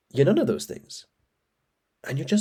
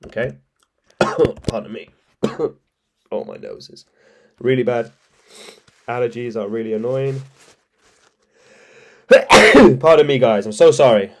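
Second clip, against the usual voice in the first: usual speaking voice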